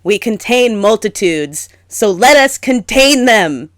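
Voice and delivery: grand quotation. Retold or imitated. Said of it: "like Walt Whitman says".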